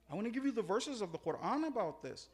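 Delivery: normal talking voice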